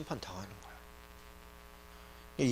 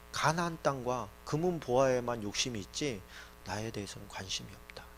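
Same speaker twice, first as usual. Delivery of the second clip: soft, sinking lower and fading out; quietly disappointed God; 3 semitones lower, 8 dB quieter than usual. Acted. God complains to Moses about the people, quietly. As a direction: let the complaint fade away quietly. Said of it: "하나님께서 그렇게 얘기해요".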